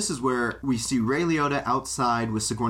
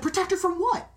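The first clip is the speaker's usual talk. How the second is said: much higher, a disbelieving squeak; incredulous retort. Retold or imitated. Ray scoffing at the mother's excuse, high incredulous. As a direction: shoot the question up high in disbelief.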